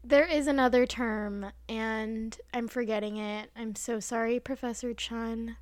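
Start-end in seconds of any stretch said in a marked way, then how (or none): none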